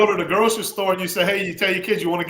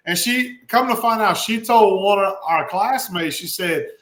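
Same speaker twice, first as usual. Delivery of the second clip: ordinary talk, nothing marked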